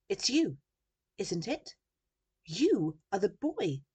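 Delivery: loud, high burst of recognition; excited shout. A narrator's reading. Journalist recognising Sam, shouting excitedly. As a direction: exclaim it excitedly, voice leaping up. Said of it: "she shouted excitedly".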